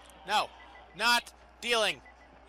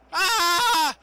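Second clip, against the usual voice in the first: startled yelp; 8 semitones higher, 10 dB louder than usual. Real, not acted. Old pet Zuko suddenly appears.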